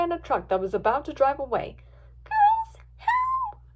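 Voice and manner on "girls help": very high, tearful call; high-pitched distressed girl; crying for help. A narrator's reading. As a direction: cry to friends as you're carried off. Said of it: "Fluttershy cried".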